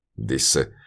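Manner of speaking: usual speaking voice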